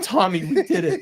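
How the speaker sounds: half laughing, half choked up; emotional. Real, not acted. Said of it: "And I'm gonna get emotional".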